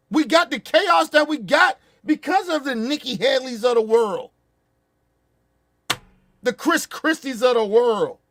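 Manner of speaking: loud, high, heated outrage; furious rant. Real, not acted. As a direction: blast him, voice up and loud. Furious rant at Chris Christie.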